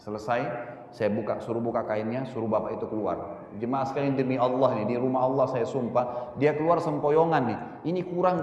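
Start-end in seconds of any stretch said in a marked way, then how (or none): none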